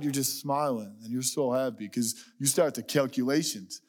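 ordinary talk, nothing marked

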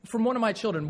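normal talking voice

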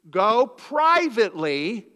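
privately drawn out slow and loud; stress on privately. Acted. Preacher stressing privately to the congregation. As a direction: lean hard into "privately", stretched out. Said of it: "You could underline that".

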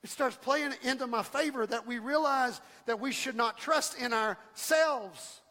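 usual speaking voice

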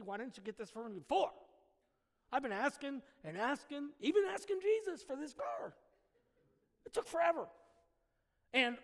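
mock spoiled whine, rising up on the Jesus bit; entitled complaint. Preacher mocking an entitled relative's gripe about a gift car. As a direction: play the ungrateful relative griping about the car.

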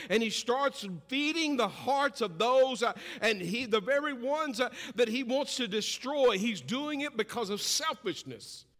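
loud, high, driving preacher cadence; full-force preaching. Acted. Preacher warning the congregation about the enemy, thundering. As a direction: hammer it home to the pews.

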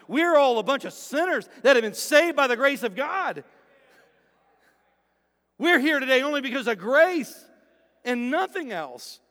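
loud, rising sermon delivery; preaching at full force. Real, not acted. Preacher to his congregation, preaching loud and passionate. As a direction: drive the point home to the church.

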